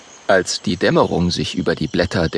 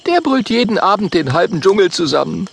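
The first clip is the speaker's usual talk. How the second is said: higher, louder call of a name; Mathilda's voice; 9 semitones higher, 3 dB louder than usual. Acted. Mathilda names the roaring tiger, higher voice. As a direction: answer calmly but higher. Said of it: "sagt Mathilda".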